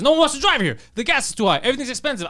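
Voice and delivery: high, loud store owner ranting about gas; retold complaint. Store owner complaining about prices, high and loud rant. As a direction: rant like a fed-up shopkeeper. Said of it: "He goes".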